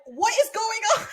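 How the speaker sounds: high, loud voice of a mom running in; imitating a panicked mother's outcry; alarmed mom. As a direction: cry out like a startled mother. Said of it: "her mom comes running and is like".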